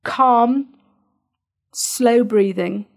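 slow, drawn-out demonstration; stretched-out words modelling slow breathing; slow and calm. Shown as a demonstration. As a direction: show the calm pace as you say it. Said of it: "we need to make sure that it is calm".

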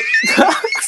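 squealing burst of laughter; high-pitched cackle; high shrieking laugh. Real, not acted. Cracks up mid-story.

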